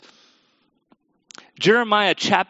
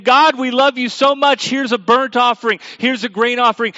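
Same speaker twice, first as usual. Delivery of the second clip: louder, higher, gushing devotion; hammed-up devotion, pitched up and loud; mock fervent worshippers; 6 semitones higher, 6 dB louder than usual. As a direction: imitate over-eager worshippers pleading with God. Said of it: "they're weeping and they're wailing and they're saying".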